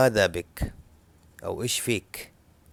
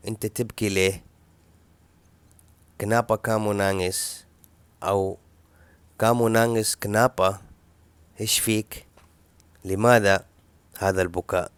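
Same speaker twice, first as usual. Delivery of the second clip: ordinary talk, nothing marked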